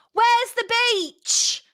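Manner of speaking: hammed-up British holidaymaker; British accent. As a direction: drawl it like an Essex tourist. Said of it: "Just in a British accent instead".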